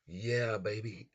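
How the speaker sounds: high, loud, thrilled yell; loud cheer. Real, not acted. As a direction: cheer it on loudly. Cheering price surge.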